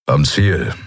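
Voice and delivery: low, rumbling grumble; a gruff pilot's low grumble; grumbled. A narrator's reading. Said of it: "brummte Kero".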